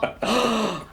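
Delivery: short, high intake of breath; offended gasp. Real, not acted. Player told she was not helpful, gasping. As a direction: gasp at the slight. Reacts to a teasing refusal.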